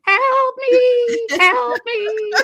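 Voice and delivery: very high, loud, breaking wail; sob-scream it as if going under; crying scream for help. Retold or imitated. Retelling the riptide, he wails for help, crying and screaming. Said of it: "I was screaming at the top of my lungs".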